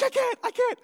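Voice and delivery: very high panicky refusal; mock-panicked squeal; imitated freak-out. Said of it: "Jaden is freaking out".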